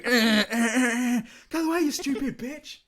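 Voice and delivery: a shrieking caricature of road rage, hammed up; annoyed driver behind. Friend imitating a driver stuck behind the old biddy, mock yelling. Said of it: "someone behind her just going".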